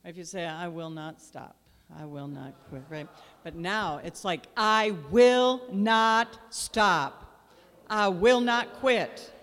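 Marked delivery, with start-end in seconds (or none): 0.4-2.8 limp, quiet mumble with no conviction; half-hearted. Shown as a demonstration. Pastor mimicking the congregation's weak, quiet mumble. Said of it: "Now you gotta say it like you mean it". 4.6-9.3 loud, slow, ringing declaration; like you mean it. Shown as a demonstration. Pastor showing the church how to shout the vow, loud and slow. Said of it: "Now you gotta say it like you mean it".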